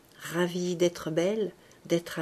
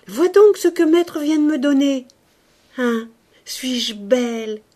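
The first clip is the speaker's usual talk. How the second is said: high, loud excited call; calling out excitedly; 7 semitones higher, 11 dB louder than usual. A narrator's reading. Young woman calling the maid to show off, eager. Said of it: "cédant à un besoin de se montrer".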